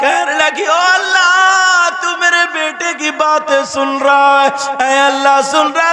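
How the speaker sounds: high, loud, slow wailing sermon half sung; tearful preaching. Acted. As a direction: preach the son's grief at full force. Preacher at full force. (ऊँची, धीमी, रोती हुई तक़रीर)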